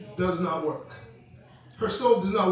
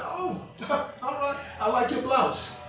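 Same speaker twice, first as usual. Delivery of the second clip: sly compliment; 4 semitones higher, 5 dB quieter than usual. Retold or imitated. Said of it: "She said".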